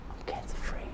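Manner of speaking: whispered under the breath. Retold or imitated. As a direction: breathe it out as a secret. Lying to wife about results.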